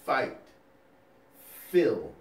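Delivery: "Fight." then "Phil." each punched loud and set apart by pauses; stress on fight and phil. Shown as a demonstration. Instructor stressing "Fight. Phil." so nursing students remember the fighters. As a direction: split the word into "Fight." and "Phil.", hitting both hard. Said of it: "Emphasis on that".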